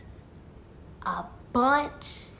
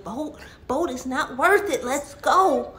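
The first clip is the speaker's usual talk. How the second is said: raised, loud, insistent plea; frantic urging; 4 semitones higher, 5 dB louder than usual. Acted. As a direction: beg a stubborn friend to come away. Seal begs goat to leave.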